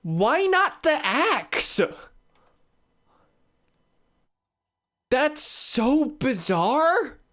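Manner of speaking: high, slow drawn-out disbelief; incredulous. Real, not acted. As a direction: stretch out the confusion. Shocked by game limitation.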